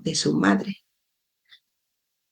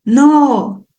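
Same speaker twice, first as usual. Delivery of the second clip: put-on high, drawn-out child's "no"; stammered protest; 7 semitones higher, 4 dB louder than usual. A narrator's reading. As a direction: voice a crying child's protest, pitched well up. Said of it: "balbuceó entonces Len".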